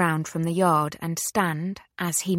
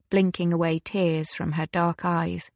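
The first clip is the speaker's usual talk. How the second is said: plain reading voice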